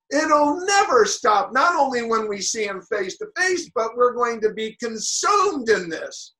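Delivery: loud, ringing preaching with rising pitch; full-force preaching. Acted. Preacher telling the congregation of God's love, fervent preaching. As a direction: preach it out, building the fervor.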